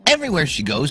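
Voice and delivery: usual speaking voice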